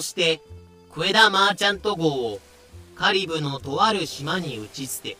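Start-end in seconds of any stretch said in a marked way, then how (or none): none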